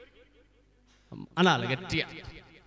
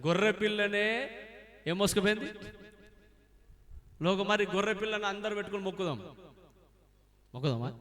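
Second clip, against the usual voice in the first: imitated objectors; 2 semitones higher, 11 dB quieter, faster than usual. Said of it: "కొంతమంది చూస్తారు".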